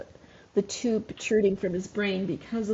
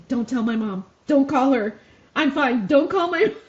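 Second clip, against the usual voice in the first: pleading son; 4 semitones higher, 6 dB louder than usual. Retold or imitated. Son voiced begging not to call.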